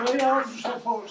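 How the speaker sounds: loud, high stubborn retort; stubborn haggling. Acted. Sam refusing to budge on his price to Noah, stubborn.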